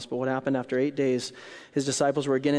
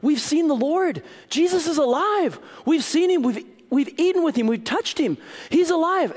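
much higher, loud, thrilled shouting; excited disciples; 6 dB louder than usual. Acted. Disciples to Thomas, excited shouting. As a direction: burst out with joyful news. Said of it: "the other disciples therefore said".

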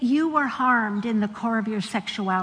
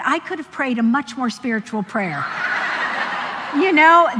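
laughing through the words; real laughter, amused at herself; laughing at her own prayer; 3 semitones higher, 5 dB louder than usual. Real, not acted.

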